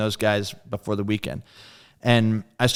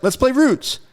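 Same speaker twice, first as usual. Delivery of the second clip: eager call; 12 semitones higher, 3 dB louder than usual. Retold or imitated. Lars eagerly starting the song.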